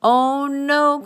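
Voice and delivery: high, loud wail of dismay; storybook "oh no" cry; cried in dismay. A narrator's reading. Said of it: "cried the goat".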